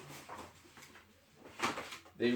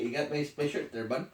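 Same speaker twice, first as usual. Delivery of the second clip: ordinary delivery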